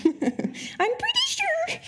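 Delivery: drawn-out, very high comic falsetto; SNL-style catchphrase. Acted. Preacher can't resist repeating an SNL bit, in drawn-out falsetto. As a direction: squeal it slowly, stretching the last word. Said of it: "That's an S N L reference".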